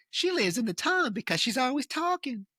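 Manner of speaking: put-on high-pitched Southern boy alter; put-on falsetto Southern boy voice.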